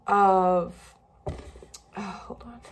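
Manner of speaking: fighting back tears, barely getting words out; whispered and choked with real tears; real crying. Real, not acted. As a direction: voice breaks into a whispered, tearful hold on. Breaks down talking about miscarriages.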